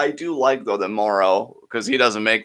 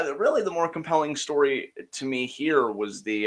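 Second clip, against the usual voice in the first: ordinary delivery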